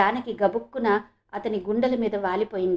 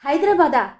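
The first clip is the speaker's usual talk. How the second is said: higher, louder burst of delight; overjoyed cry; 4 semitones higher, 7 dB louder than usual. A narrator's reading. Janaki hears they are in Hyderabad, overjoyed cry. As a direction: exclaim it, wild with joy. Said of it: "వెర్రి ఆనందంతో అరిచింది".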